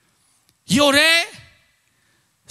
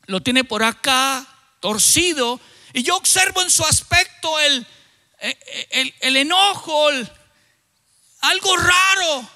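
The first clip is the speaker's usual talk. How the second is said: normal talking voice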